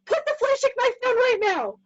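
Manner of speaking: high-pitched mother's scream; acted parental yelling; screamed command. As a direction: scream an urgent order at the boys. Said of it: "I have to scream".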